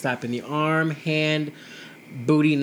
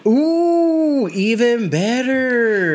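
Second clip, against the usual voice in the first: higher, louder, slow; delighted gasp; 8 semitones higher, 8 dB louder, much slower than usual. Real, not acted. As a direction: savor the good news. Learning they take the train.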